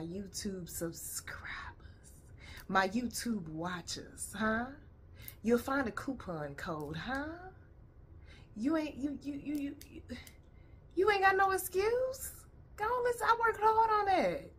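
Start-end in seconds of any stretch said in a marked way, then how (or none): 0.0-2.1 breathy whispered aside, slowed right down; playful whisper. Acted. Plugging the audiobook to viewers, in a playful whisper. Aside during promo plug. 8.6-14.5 mock-pleading, pitched way up; teasing high voice. Teasing viewers who skip her audiobook, in a high mock-whiny voice.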